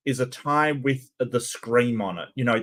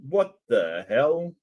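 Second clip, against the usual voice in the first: usual speaking voice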